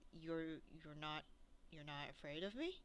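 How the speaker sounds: soft, slightly deep voice of an armored rider; lightly voiced ominous knight, hushed; deep echoey voice. Game master voices the undead rider to players, lightly deep and echoey. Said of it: "in this very deep, echoey voice".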